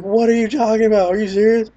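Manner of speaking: can't believe what you just heard; shocked disbelief. Retold or imitated. Friends in the car reacting to the puke news, shocked disbelief.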